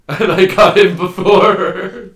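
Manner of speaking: high, loud laugh through the words; slow, helpless laughing delivery. Real, not acted. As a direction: crack up while delivering the punchline. Laughs while finishing own anecdote.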